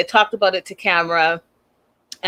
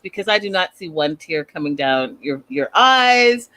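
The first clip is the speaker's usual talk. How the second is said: normal talking voice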